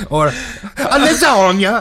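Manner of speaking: hammed-up Italian accent; cartoon Italian accent; mock Italian accent. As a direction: put on a fake Italian accent for a joke. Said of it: "if that's an Italian accent".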